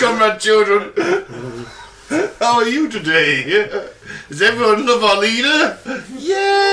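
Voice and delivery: put-on children's show host.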